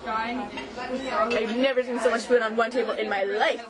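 ordinary talk, nothing marked

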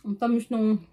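talking while chewing; chewing food. Real, not acted. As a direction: mouth partly full. Seen 1: Cheeks bulge and lips purse while she eats from plate throughout.

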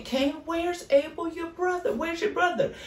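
high, calling voice of God; God calling to Cain, high and searching; voicing God. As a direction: call out to Cain from above, searching. Preacher voicing God's question.

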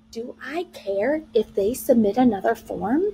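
ordinary talk

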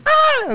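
a sudden very high shrieking scream; a friend's mock-scream showing how to yell for help; imitated scream. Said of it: "Así le hizo".

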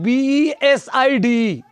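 बी ई एस आई डी ई letter by letter; name each letter in बी ई एस आई डी ई; spelled out. Shown as a demonstration. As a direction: spell beside aloud, each letter named. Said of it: "जैसे आपको लिखना है".

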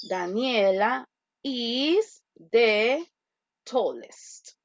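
slow, drawn-out model sentence; slow dictation. Shown as a demonstration. Teacher repeating the model sentence slowly. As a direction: read it out slowly for students. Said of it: "teacher modeling sentence".

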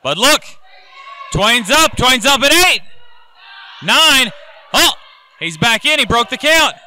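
excited ringside play-by-play; wrestling commentator. Acted. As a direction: call the near fall live. Live play-by-play of the match.

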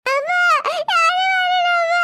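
put-on sobbing shout of a little boy; wailing child. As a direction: bawl for mom like a toddler.

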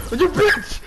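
sudden high yell of shock; startled yell. Real, not acted. Gamer yelling at a sneaky killer, startled. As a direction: yelp it out at the ambush. Surprised in-game ambush.

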